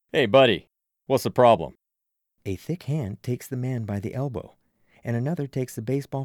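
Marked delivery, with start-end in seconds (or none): 0.1-1.8 loud, confronting hey buddy; challenging call. Acted. Frank challenging the pushy stranger, loud. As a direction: step in and call him out loudly.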